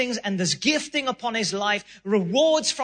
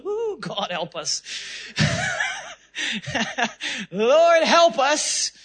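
laughing mock plea; 5 semitones higher, slower than usual. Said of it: "I'm like".

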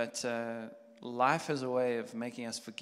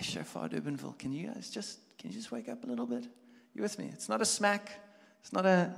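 gentle nudge; 7 semitones higher than usual. Pretend. The Lord gently coaxing the congregation awake. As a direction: coax a sleepy church awake. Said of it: "He just wants to go like".

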